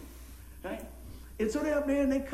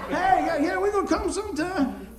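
louder, higher, over-eager promise; mock eager guy; 8 semitones higher, 7 dB louder than usual. Bar guy promising the preacher he'll come to church. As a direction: overplay the empty promise. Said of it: "bar guys brushing off preacher".

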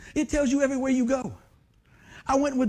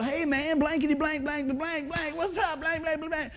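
higher, loose rocker greeting with censored cusses; rowdy buddy greeting; 6 semitones higher than usual. Retold or imitated. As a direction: play an old bandmate swearing cheerfully. Rock-and-roll buddies cursing.

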